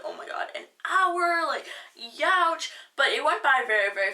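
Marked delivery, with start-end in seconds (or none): 0.0-2.7 mock shocked dismay. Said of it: "I was like".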